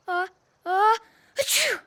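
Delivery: building gasps then a loud achoo; sneeze. Acted. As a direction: wind up and sneeze. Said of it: "first you sneezed".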